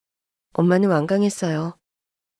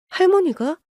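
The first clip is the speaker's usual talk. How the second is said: shocked disbelief; 10 semitones higher than usual. Acted. Sister hearing grandmother came, shocked and high. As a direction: jump way up in pitch, stunned.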